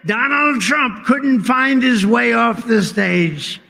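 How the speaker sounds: mock outrage. Mocking the fake news, slow loud shout. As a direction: drag it out like a hammed-up outrage.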